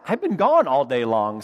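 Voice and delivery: usual speaking voice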